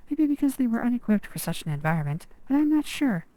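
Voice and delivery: ordinary talk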